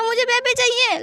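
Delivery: high, whining plea; whiny child. Acted. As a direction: call after him like a sulking kid. Whining after fleeing husband.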